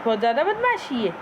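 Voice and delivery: pretend-stern owner scolding her parrot; mock scolding. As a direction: tell off the pet in mock anger, half teasing.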